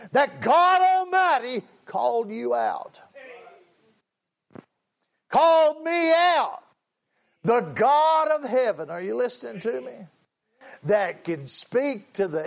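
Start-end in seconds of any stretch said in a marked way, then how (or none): 0.1-8.9 fervent preaching. Real, not acted. Preacher's voice climbs and swells. 8.9-9.9 quick soft aside. Real, not acted.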